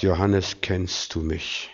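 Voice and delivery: hushed, low, searching questions; quiet inner voice, gently probing; Heiliger Geist. Retold or imitated. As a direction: speak softly to one person lying in bed. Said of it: "der Heilige Geist sagt".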